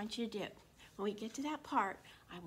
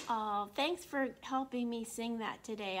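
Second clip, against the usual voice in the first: ordinary delivery, nothing marked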